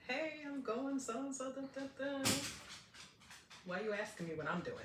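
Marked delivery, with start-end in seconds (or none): 0.1-2.3 mock announcement. Announcing where she's going, mock sing-song. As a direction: draw it out high, like a breezy notice. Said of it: "I would have announced it to you".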